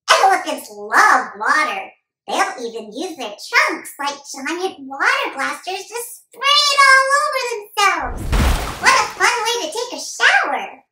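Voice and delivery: put-on high, loud excited kid voice; urgent little boy on an elephant hunt; excited young boy.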